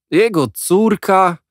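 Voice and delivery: high, loud, slow scornful echo; mock the answer; exasperated outburst. A narrator's reading. Boys exploding at Jack's news, high and scornful. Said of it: "niemal wybuchnęliśmy".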